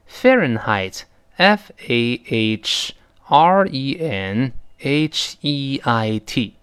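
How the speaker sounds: slow letter-by-letter spelling of "Fahrenheit". Shown as a demonstration. Teacher spells the hard loanword for students, slow spelling. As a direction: say "Fahrenheit", then each letter separately and slowly. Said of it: "我给了大家一个注释".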